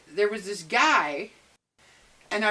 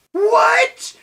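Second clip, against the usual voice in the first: shocked; 13 semitones higher, 10 dB louder than usual. Retold or imitated. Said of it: "And I was like".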